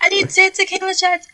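high-pitched mock drink order; bar customer. Customer ordering shots from the bartender, high put-on voice.

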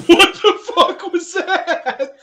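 laughing, high and drawn out; cracking up mid-sentence; laughing hard. Real, not acted. Cracking up at a glitch.

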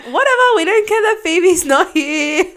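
high, loud mocking singsong of uncaring kids; mock carefree taunt. Friend voices sisters having fun on the slide, mock dismissive.

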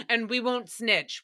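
ordinary talk

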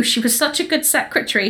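ordinary talk, nothing marked

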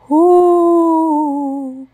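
high, loud ghostly ooh; a drawn-out spooky cave moan; spooky cave wail. Acted. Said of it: "cried the deep, dark, mysterious cave".

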